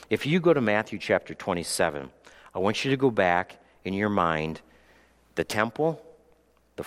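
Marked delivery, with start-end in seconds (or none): none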